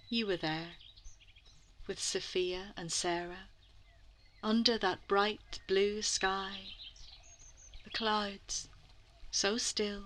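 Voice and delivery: soft, dreamy storytelling voice; hushed imagining. A narrator's reading. Guide telling children to imagine the painting, hushed. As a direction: draw the listeners gently into the picture. Inviting listeners to imagine the scene.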